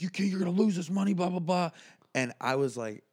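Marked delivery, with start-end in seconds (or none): 0.0-1.7 fast, higher, louder rant; ranting boss. Retold or imitated. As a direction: rattle off the boss's tirade. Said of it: "He starts swearing at me".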